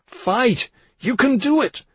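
high, loud rallying cry; urgent yell. A narrator's reading. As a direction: yell to rouse someone fighting for life. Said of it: "Dan yelled straight into Leo's hypersensitive ear".

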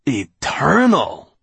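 slow, scoffing echo of the word; scornful disbelief. Acted. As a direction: make the word sound ridiculous. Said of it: "as if there could be nothing more ridiculous".

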